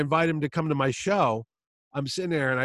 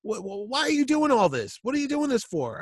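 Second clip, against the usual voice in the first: exasperated Chaykin; 10 semitones higher than usual. Retold or imitated. Chaykin interrogating the fan.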